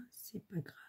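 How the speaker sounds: tossed-off whisper aside; hushed never-mind; whisper to herself. Real, not acted. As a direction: whisper it, shrugging it off. Muttering to herself, choosing a piece.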